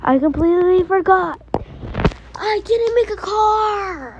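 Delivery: high, quick fluster; panicked realization. Real, not acted. Girl realizing she forgot her car. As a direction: blurt it out in alarm.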